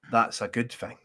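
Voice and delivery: usual speaking voice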